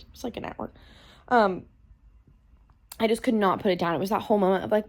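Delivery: normal talking voice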